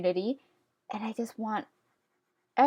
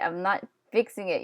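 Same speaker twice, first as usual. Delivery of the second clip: usual speaking voice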